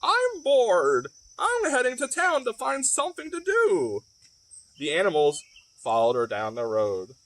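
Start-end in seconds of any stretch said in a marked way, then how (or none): 0.0-4.0 squawking hen. Acted. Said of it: "the hen squawked".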